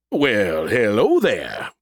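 slow, drawling fake Southern gentleman; hammed-up deep Southern drawl; terrible Southern accent. A narrator's reading. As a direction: put on an awful Southern accent, drawn right out. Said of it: "in a deep voice, trying my terrible Southern accent".

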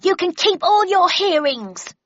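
high, loud, drawn-out yell; enraged shout. A narrator's reading. Accused man storms out of his hearing, enraged shout. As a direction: shout in outraged defiance. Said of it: "fairly shaking with rage".

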